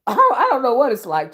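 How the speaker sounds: talking through loud high laughter; laughing. Real, not acted. A vlogger cracking up with a viewer, laughing loud. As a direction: laugh your way through the words. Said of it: "[name] cracking up at me".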